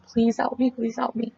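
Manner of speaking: high, softened re-enacted plea; retold begging. Caller begging the emergency dispatcher for help, acted-out high plea. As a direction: plead in a small, high voice, retelling it. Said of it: "pleading and begging".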